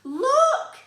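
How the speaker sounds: Mum calling. Acted. Mum showing Oliver the fruit, very high and loud. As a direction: call out to show him. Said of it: "said Mum".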